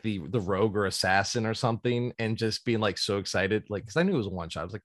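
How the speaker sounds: ordinary delivery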